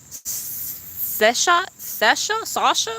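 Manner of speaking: high rising question on "Seshat? Sesha? Sasha?"; questioning try-outs. Real, not acted. Vlogger guessing a viewer's name, high questioning lilt on "Seshat? Sesha? Sasha?".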